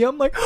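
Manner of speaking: sharp high gasp of delight; excited gasp. Retold or imitated. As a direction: gasp like you just spotted a puppy. Excited at seeing puppy.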